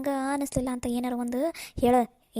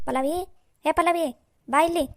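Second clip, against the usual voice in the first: drawn-out call across the house, louder and higher; 4 semitones higher, 4 dB louder, slower than usual. Acted. Mother-in-law calling her daughter-in-law from the kitchen, loud drawn-out call.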